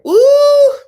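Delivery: sudden high loud "Ooh!" of shock; surprised yelp. Real, not acted. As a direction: yelp it out, genuinely startled. Reacting to a shocking show moment.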